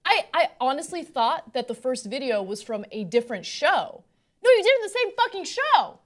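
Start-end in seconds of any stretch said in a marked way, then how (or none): 4.4-6.0 exasperated outburst. Real, not acted. Incredulous at hypocrisy.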